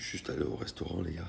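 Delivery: sore throat whisper; barely able to talk, voice nearly gone; losing voice. Real, not acted. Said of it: "Je peux pas parler".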